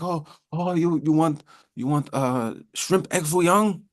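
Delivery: high takeout worker with a put-on Chinese accent; caricatured Chinese-accented questioning; mock Chinese accent. Acts a Chinese takeout order-taker.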